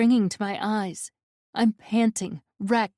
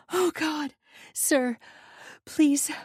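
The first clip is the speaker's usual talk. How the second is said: breaking voice; 8 semitones higher than usual. A narrator's reading. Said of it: "my voice breaking into gasps".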